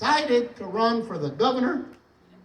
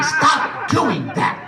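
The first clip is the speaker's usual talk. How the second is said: very slow, high mock scolding; mad wife; 5 semitones higher, much slower than usual. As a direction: imitate a fed-up wife, stretching every word. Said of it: "my wife would get mad at me".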